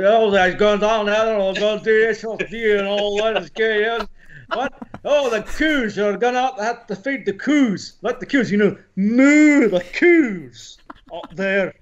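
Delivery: slow, high, very thick Scottish brogue; loud broad Scots, words almost impossible to make out; barely intelligible Scottish accent. Shown as a demonstration. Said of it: "where you could barely understand anything".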